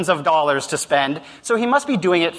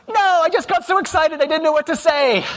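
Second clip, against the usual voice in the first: sheepish intern; 11 semitones higher than usual. Retold or imitated. Katie admits she didn't negotiate.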